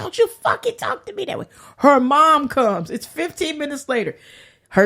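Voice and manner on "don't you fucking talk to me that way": high, fast mock scolding; mock angry sheriff. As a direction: fire it off like an offended cop.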